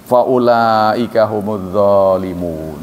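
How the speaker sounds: slow chanted Arabic recitation; slow reverent tilawah; Quranic recitation. Acted. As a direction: draw out the verse like a reciter. Reciting Arabic verse slowly.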